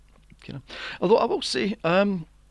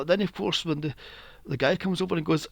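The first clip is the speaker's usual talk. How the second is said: ordinary delivery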